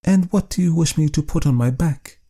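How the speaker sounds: wife snapping back at her husband, impatient. Acted. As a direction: push the question out impatiently. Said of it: "said impatiently".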